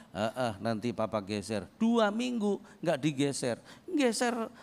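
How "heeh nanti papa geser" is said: couldn't care less, maybe later; lazy brush-off. Retold or imitated. Husband brushes off his wife's second request, lazy low mumble.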